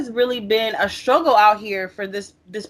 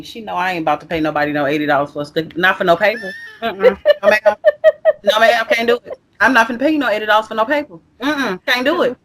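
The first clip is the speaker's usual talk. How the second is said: fast, emphatic playful refusal; sassy other side; faster than usual. Real, not acted. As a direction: let the sassy side out, refusing point-blank. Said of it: "you seen my other side come out just now".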